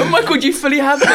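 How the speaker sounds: fast, high, loud laughing cry; laughing shout. Real, not acted. Admitting to her friend that he fooled her, fast laughing shout. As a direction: shriek through laughter.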